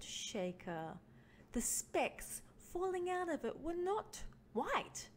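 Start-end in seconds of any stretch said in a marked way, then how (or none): none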